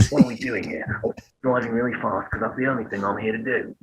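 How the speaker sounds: soft, low-key restricted British tough-guy voice; Jason Statham. Acted. Showing a narrower British accent, quiet action-star impression. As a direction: hold the British sound back, quiet and restricted. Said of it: "You can do Jason Statham".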